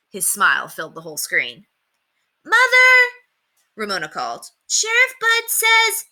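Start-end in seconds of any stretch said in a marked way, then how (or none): 2.4-3.1 put-on high, loud child's call. A narrator's reading. Ramona calls her mother in the kitchen, loud and high. 4.7-6.0 put-on high, slow child's voice; little girl. A narrator's reading. Ramona tells her mother, in an eager high child's voice.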